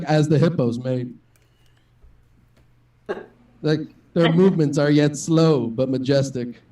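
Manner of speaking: low, measured narrator impression, slowing down; nature documentary narrator. Comic mimics a man narrating hippos mating, documentary narrator voice. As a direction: imitate a solemn wildlife voiceover, drawing out the phrases. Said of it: "the guy who's, like, narrating the whole thing".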